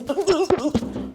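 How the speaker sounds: rapid high puffs of breath; exaggerated, fast hyperventilation noises; mock hyperventilating. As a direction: imitate frantic panting. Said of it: "hyperventilating, right?".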